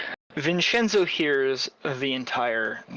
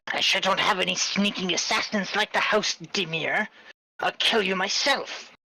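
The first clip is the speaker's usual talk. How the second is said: higher, baffled and scornful retort; confused ringmaster; 6 semitones higher than usual. Acted. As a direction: sound thrown, then dismissive. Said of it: "is audibly confused and says".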